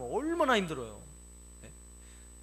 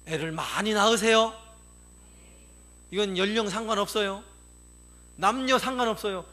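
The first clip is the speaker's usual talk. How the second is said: emphatic exhortation; 2 semitones higher, 6 dB louder than usual. Real, not acted. Preacher exhorts congregation.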